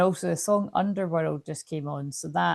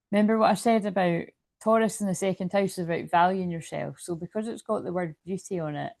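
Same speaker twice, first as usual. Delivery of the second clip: ordinary delivery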